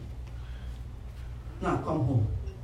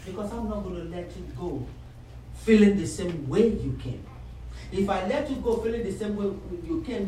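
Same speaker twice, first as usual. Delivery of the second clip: ordinary talk, nothing marked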